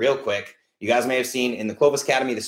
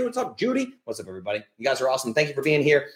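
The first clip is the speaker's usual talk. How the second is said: ordinary delivery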